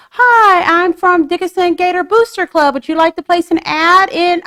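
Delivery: high, loud chirpy sales pitch; booster club caller. Retold or imitated. Club member phones a business for ads, perky pitch. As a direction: put on a perky solicitation call voice. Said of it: "you have someone that calls your business".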